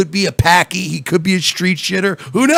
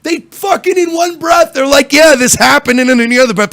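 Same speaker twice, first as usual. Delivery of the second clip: exasperated loud rant; 9 semitones higher, 6 dB louder than usual. Real, not acted.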